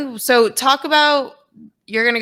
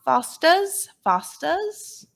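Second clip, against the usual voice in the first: put-on playful, higher-pitched Aussie accent; teasing fake Aussie accent with rising ends; mock Australian accent; 4 semitones higher, slower than usual. Teasing with Australian beer name.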